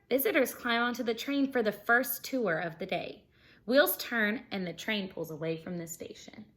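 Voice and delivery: bright, lively storybook reading; animated read-aloud for kids; reading aloud to children. Acted. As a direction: read it like story time for a class. Reading picture book to class.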